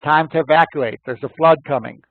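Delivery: imitated loudspeaker warning, high and loud; blaring announcement. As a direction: call it out over blaring horns. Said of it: "with the horns blaring, saying".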